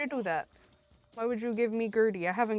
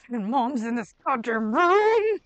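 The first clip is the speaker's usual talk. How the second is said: loud cry of dismay, building; exasperated outburst; 7 dB louder than usual. Real, not acted. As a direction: curse the game loudly. Outburst at game boss.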